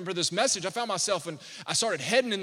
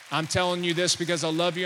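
ordinary delivery

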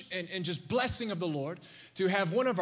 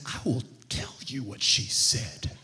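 much lower, mock-sinister promise; ominous dad; 10 semitones lower than usual. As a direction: drop way down like a dad about to scare his kid. Dad answers son ominously.

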